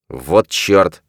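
ordinary delivery

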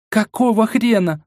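high-pitched outraged cry; angry roar. A narrator's reading. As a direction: burst out in indignation. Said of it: "взревела она".

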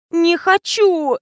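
high, long drawn-out whine; tearful whine. A narrator's reading. Fatima refusing to drink milk for the director, tearful drawn-out whine. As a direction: draw it out in a high, weepy protest. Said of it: "плаксиво протянула Фатима".

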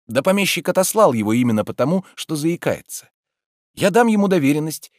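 a hint of a fretful gentleman; lightly voiced Oblomov. A narrator's reading. Oblomov explains plans.

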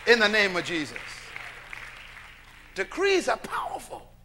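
loud, rising call to the congregation; full-force preaching. Acted. Preacher calling out to his congregation, full-force preaching.

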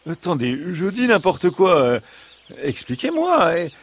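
high-pitched fox pleading its case; high, protesting animal-character voice; imploring fox. A narrator's reading. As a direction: implore as a wronged storybook fox. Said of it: "Implora le renard".

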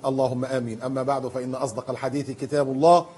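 measured, solemn preacher's opening; formal sermon opening. Acted. Preacher opening his talk to the congregation, solemn recitation.

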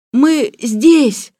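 high, loud strained answer; hoarse call back. A narrator's reading. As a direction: shout back as if worn out from yelling. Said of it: "хриплым голосом отозвался Сирил". (хриплый крик в ответ)

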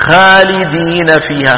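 خالدين slowly with a light alif where it should be heavy; wrong thinned alif in خالدين; beginner's thin alif; thin alif wrongly. Shown as a demonstration. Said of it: "بعض إخواننا المبتدئين".

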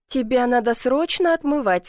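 plain reading voice

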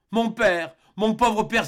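a daughter's anguished cry. A narrator's reading. Mary kneeling before Lord Glenarvan, anguished cry. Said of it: "s'écria Mary Grant".

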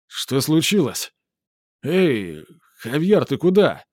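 slow, slurred tipsy calling; drunken voice. Acted. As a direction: drawl it like the town drunk. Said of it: "пьяным голосом".

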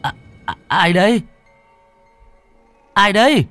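high, stuttering, terrified calls into the dark; stammering in fright. A narrator's reading. Terrified boy stammering at the door in the night, high and frightened. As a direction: call out shakily, afraid of what is outside. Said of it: "làm cho Phùng ú ớ".

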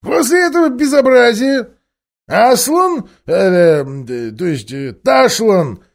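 high, loud ape voice, stammering over the name; squeaky high ape puffing himself up; high, loud, pushy ape; sly ape. Acted. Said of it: "Обезьян продолжал".

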